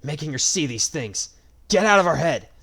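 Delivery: angry shout. A narrator's reading. Furious brother confronting villain.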